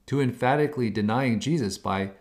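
normal talking voice

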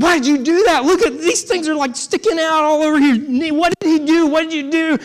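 high, loud frantic scolding mother; alarmed mom. Retold or imitated. Mother scolding her son over the husband's knees, frantic and high. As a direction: demand answers in a frantic high voice.

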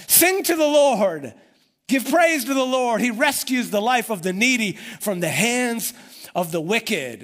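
exultant preacher's praise, easing at the end; joyful praise. Retold or imitated. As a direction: burst out in triumphant worship. Jeremiah's sudden burst of praise.

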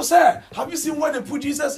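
high, loud appeal of searching Mary; urgent plea. Acted. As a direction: call out to the stranger urgently. Mary pleading with supposed stranger.

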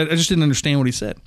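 plain reading voice

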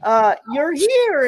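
lightly voiced girl calling out happily; a child running in, thrilled to see a friend; excited little girl. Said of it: "she said".

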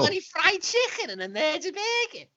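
high-pitched comic Scouse; exaggerated Scouse parody; false Scouse accent. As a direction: put on a hammed-up Scouse voice. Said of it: "we do this false Scouse accent".

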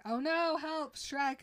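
high, loud mock panic; panicked cry. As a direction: cry for help in a hammed-up panic. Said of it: "they scream at each other".

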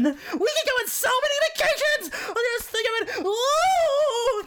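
high squealing woman, thrilled; excited cry. A narrator's reading. Woman celebrating the prize, high squealing thrill. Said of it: "cried a woman Robinson".

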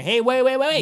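high, loud panicked wait; alarmed shout. Retold or imitated. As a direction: cry out to stop them. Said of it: "they're saying".

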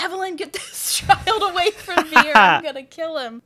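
hammed-up exasperation; mock furious coach. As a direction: rant it as a movie quote. Coach threatening over annoying child.

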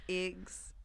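"eggs" mimicked with a long Midwestern "ay" vowel. Friend teasing her about Midwest speech, "eggs" as "aygs". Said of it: "sounds like she's from the Midwest".

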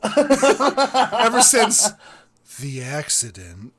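cracking up mid-quote; laughing retelling. Retold or imitated. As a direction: giggle through the tragic line. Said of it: "laughing my ass off".

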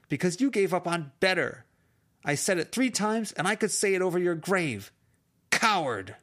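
high, loud furious screaming curse. Acted. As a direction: scream the curse after him, building to Coward. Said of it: "She raised her voice".

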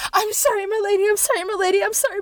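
five kneeling monks apologising in unison; chorus of monks. Acted.

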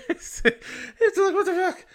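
high-pitched laugh through the words; giggling what the fuck. Real, not acted. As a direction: laugh out the disbelief. Laughing in disbelief at the story.

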